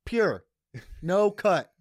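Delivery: high, loud hammed-up dealer pitch; loud mock dealer promising pure product; mock drug dealer. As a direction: sell it like a dealer hyping his stash. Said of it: "I was like".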